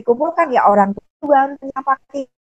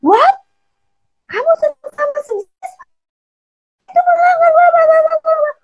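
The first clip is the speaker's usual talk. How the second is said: angry parent. Retold or imitated. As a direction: shriek it like a shocked, furious parent. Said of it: "Ketika dia marah".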